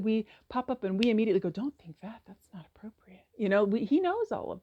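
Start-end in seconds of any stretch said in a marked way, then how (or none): 1.5-3.2 whispered, self-scolding hush. Retold or imitated. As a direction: hush the thought away in a whisper. Hushing our own thoughts.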